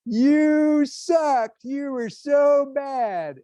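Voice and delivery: put-on high, drawn-out kid's taunt; mock schoolyard heckling, stretched out high and loud; retold playground jeer. Friends taunting him on the playground, jeering.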